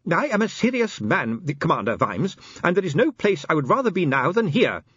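high-pitched, earnest little inspector, mildly nervous; trembling resolve. A narrator's reading. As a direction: declare yourself bravely though scared. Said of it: "his teeth chattering".

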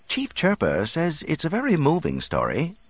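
high-pitched, proper robot interpreter; droid explaining. A narrator's reading. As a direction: relay the verdict as a prim droid. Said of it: "the droid explained".

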